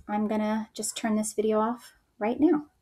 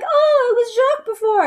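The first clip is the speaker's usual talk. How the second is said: very high, louder mock Frenchman; hammed-up French banter, pitched way up; pretending to be French; 13 semitones higher, 5 dB louder than usual. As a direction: play along in a high, put-on French soldier's voice. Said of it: "pretending like they're French".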